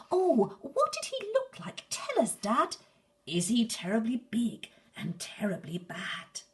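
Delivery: put-on high, loud eager child; squeaky excited little monster; Gruffalo's child. Gruffalo's child begs her dad for details, high and eager.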